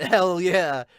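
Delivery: ordinary delivery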